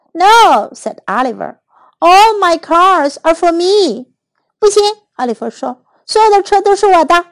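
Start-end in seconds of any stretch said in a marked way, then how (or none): 0.1-0.7 put-on high, loud childish no; voiced little boy saying no; firm refusal. A narrator's reading. Oliver refuses to share his cars. 2.0-4.1 slow, higher-pitched insistence; drawn-out, high possessive whine; possessive child. A narrator's reading. Oliver claims all his cars. 6.1-7.3 high, quick insistence; high-voiced little boy refusing to share; possessive child. A narrator's reading. Oliver claims all his cars.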